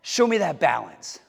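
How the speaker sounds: ordinary talk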